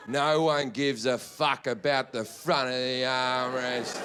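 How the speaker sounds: low, slow, drawn-out disdain; scornful rant. Acted. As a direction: stretch it out low with heavy contempt. Acted rant at seatmate over armrest.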